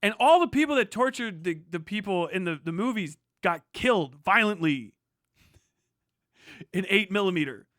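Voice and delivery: ordinary delivery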